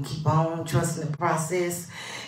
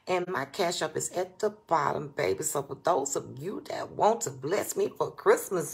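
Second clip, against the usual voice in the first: ordinary delivery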